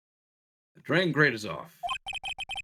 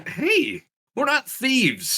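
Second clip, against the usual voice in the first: high, slow indignant protest; mock indignant; 12 semitones higher, 3 dB louder, much slower than usual. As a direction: drag it out, offended.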